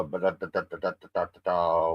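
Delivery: ordinary talk, nothing marked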